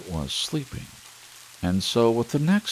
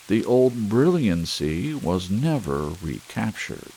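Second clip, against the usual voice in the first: ordinary delivery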